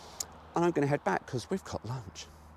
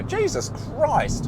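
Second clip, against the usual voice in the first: slow, higher hammed-up exclamation; mock exasperated outburst; 4 dB louder, much slower than usual. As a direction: drag it out in mock disbelief. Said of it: "and you go".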